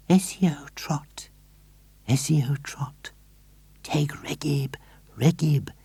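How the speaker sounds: high, breathy whisper of a spell; whispered magic words. A narrator's reading. Missus Silver reading magic words to tortoise, whispered. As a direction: whisper the chant close to the tortoise. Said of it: "she whispered".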